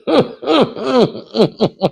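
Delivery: real high chuckling laugh in bursts; long laugh. Real, not acted. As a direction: laugh it off at length, high-pitched. Laughs at idea of Rubio.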